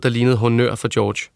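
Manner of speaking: ordinary talk, nothing marked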